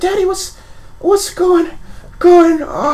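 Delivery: put-on high, halting child voice; young boy. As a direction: play a confused little boy faltering. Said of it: "like, a young boy".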